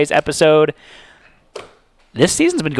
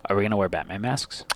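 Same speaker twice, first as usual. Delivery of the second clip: ordinary delivery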